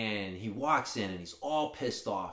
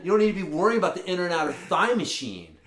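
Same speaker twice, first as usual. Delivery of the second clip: raised, fast disbelieving rant; incredulous rant; 5 semitones higher, 5 dB louder than usual. Retold or imitated. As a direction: hit him with how absurd it is. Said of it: "I'm like".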